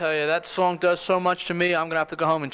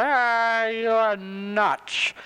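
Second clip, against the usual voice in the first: drawn-out, higher-pitched bar heckle; jeering barflies; 4 semitones higher, much slower than usual. Acted. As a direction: jeer like a whole barroom of doubters. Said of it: "all the people in the bar say".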